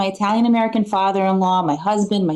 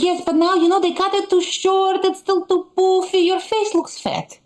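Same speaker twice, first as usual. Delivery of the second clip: put-on much higher, critical Eastern European accent; 10 semitones higher than usual. Mother finding new faults, Eastern European accent. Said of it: "She's like".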